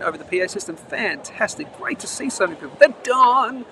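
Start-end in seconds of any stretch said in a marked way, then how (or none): 2.8-3.6 higher and louder, a happy shout-out; delighted welcome; excited greeting. Real, not acted. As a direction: call out to a regular you're glad to see. Greeting a returning viewer in chat.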